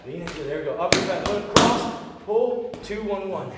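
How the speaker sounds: loud, rising combo calls; calling combos. Shown as a demonstration. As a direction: call each punch with growing force. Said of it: "any cues that I have, you guys are gonna hear".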